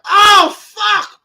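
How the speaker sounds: high loud cry of pain; pained yell. Retold or imitated. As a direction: cry out as the delayed pain hits. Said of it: "it's like".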